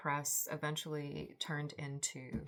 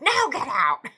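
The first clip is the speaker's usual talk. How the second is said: high, loud angry yell with a knife; threatening shout; 8 semitones higher, 17 dB louder than usual. Retold or imitated. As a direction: drive him out, much higher and louder. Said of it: "She's like".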